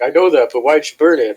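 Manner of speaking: mock an exasperated boss; imitated berating. Supervisor pressing cook about burnt pita, imitated exasperation.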